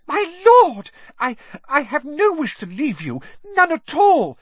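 high, loud panicked squeal; cringing servant squealing in fear; frightened servant. Acted. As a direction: plead desperately not to be doubted.

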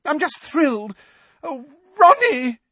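very high-pitched gushing mum; overjoyed, doting high voice; thrilled mother. Acted. Gushing mother thrilled for her son.

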